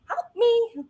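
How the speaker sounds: high, loud cry of "Help me"; a tiny inner child crying out; small voice screaming for help. Retold or imitated. Little inner child screaming to be helped, high pleading cry. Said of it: "the little person inside of you screaming".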